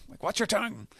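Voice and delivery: high-pitched mock scolding; indignant comeback. Insulted grandma scolds the girl, mock-indignant. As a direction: shut them up in a high, put-on voice.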